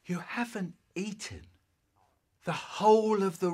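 hushed, disbelieving whisper; whispered suspicion. Acted. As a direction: whisper the dawning accusation.

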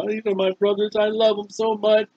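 soft, quiet mock-weepy voice; half-hearted teary hush; mock bawling. Joseph alone in his chamber, mock crying softly. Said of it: "he starts bawling".

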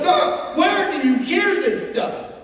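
high, loud, drawn-out disbelief; mock-exasperated dad, voice shooting up; exasperated retort. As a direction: stretch it out in loud, high incredulity. Dad's exasperated retort to daughter.

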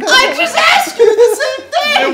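shouting while laughing. Real, not acted. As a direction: yell it through laughter. Heated playful argument over cards.

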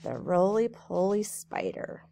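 lilting storybook read-aloud; reading to children. A narrator's reading. Teacher reading a rhyming book to kids, lilting. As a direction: read it brightly to a group of kids.

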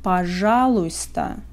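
ordinary delivery

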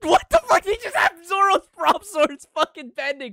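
loud, high stunned yells; amazed shouting. Real, not acted. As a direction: shout out in disbelief at the screen. Hype reaction to fight scene.

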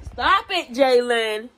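high, loud reprimand; mother's sharp warning; scolding call. Real, not acted.

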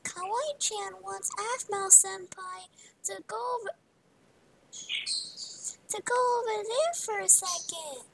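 high, cutesy character voice; Kawaii-chan. Acted. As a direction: pitch it up and stretch it out like a cutesy character. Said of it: "an amazing impression of Kawaii-chan".

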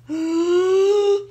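loud, high shocked intake of breath; sharp gasp. Acted. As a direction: gasp in alarm. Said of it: "Dakota gasped".

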